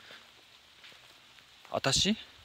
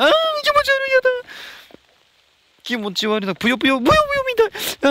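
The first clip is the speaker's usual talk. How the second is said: high, loud, giggly squeal of disgust at ぷよぷよ; half laughing, half grossed out squeal; squealing giggle over something squishy underfoot; 11 dB louder than usual. Real, not acted. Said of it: "笑ってるようにしか聞こえない".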